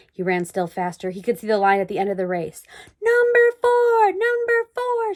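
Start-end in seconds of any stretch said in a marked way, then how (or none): 3.0-5.1 high, loud, drawn-out cheer; crowd shouting. A narrator's reading. Crowd cheering runner number four, loud high chant. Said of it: "shouted the people".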